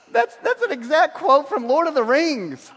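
high, loud comic objection; incredulous protest. Retold or imitated. Retold disbelief at a borrowed prophecy.

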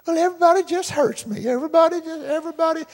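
high, fast complaining voice; mock a sulky churchgoer; whiny self-pity mimic. Mocking a self-pitying person.

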